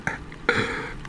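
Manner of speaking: short, soft disbelieving laugh; real laugh. Real, not acted. Angler realises he just caught an eel, soft laugh. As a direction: laugh under your breath in disbelief.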